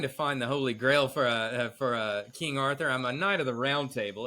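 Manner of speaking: low, knightly put-on voice; Lancelot introducing himself. Lancelot explains his quest to the father, low-voiced. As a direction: state the quest in a low register.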